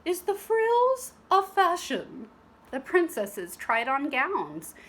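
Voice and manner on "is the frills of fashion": high, louder prim headmistress voice; a grand welcome to the ladies; prim teacher. Acted. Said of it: "said Madame Gertrude".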